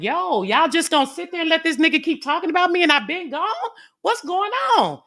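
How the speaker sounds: loud, very high indignant outburst; pissed-off protest. Retold or imitated. Woman challenging the silent panel after she left, indignant. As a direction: re-enact calling everyone out, high and loud. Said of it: "now I'm getting pissed".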